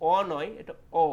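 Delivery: the two অ sounds said loud and apart, first rejected then the right one; vowel contrast demo. Shown as a demonstration. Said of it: "তো এটা হচ্ছে অ নয়". (জোরে দুই অ-এর পার্থক্য দেখানো)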